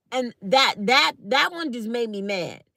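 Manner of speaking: usual speaking voice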